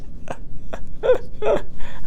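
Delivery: high breathy giggle; squeaky little chuckle; laugh at own joke. Real, not acted. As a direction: crack up at your own joke, high-pitched. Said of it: "I cracked me up".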